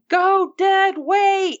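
high, slow, drawn-out yell; coaching the screen, urging a hostage to go limp. Retold or imitated. Fan yelling at the TV, high and slow. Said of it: "And I'm just yelling".

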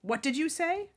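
spat-out question, loud and high; angry master demanding an answer; Mister Malfoy. Acted. Master challenges the elf over the sock, spitting it out. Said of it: "spat Mister Malfoy".

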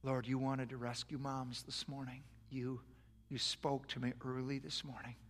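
hushed, earnest prayer over music; soft prayer. Real, not acted. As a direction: pray softly for the moms.

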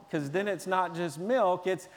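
ordinary talk

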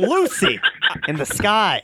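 hammy, halting recital with a big pause; Shatner impression. Reader playing along with the teasing, Shatner impression. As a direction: declaim the song title in dramatic chunks.

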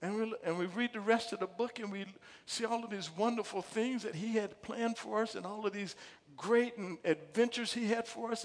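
usual speaking voice

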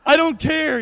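high, loud brush-off; exasperated shout. Retold or imitated. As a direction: wave the apology away. Sprayed, brushing off apology.

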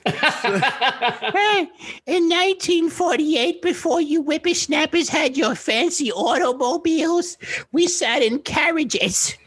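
put-on very high, slow cranky old-lady voice; strangled, squeaky old-woman caricature; Mildred. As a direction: reminisce like a scolding cartoon granny. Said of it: "You sound like a fucking woman named Mildred".

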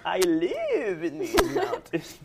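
put-on high, slow, cracking up old lady; a wobbly old crone, laughing through it. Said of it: "Okay, Rumpelstiltskin, calm down".

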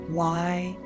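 ordinary talk

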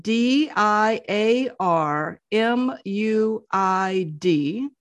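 letters spelled one by one, slowly; spelling it out. Shown as a demonstration. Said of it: "his first name is spelled".

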